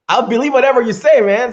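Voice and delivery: excited. Real, not acted. Excited praise of accent.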